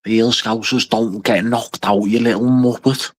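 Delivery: hammed-up Scouse fighter trash talk, drawn out; Paddy Pimblett Scouse accent. Streamer imitating a fighter taunting a knocked-out opponent, Scouse accent. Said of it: "I can't even do a Scouse accent".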